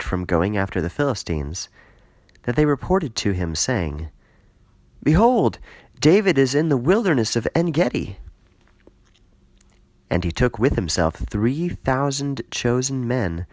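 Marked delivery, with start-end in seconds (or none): 5.0-8.2 messenger's call. Acted. Messengers announce to Saul.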